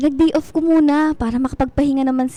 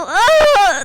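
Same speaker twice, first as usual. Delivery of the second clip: pain cry; 3 semitones higher, 6 dB louder than usual. Acted.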